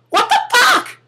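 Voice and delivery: squeaky falsetto disbelief, falling at the end; a comic high yelp, this time dropping; shocked. Pretend. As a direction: squeal it, landing it down. Shocked person facing the cicada.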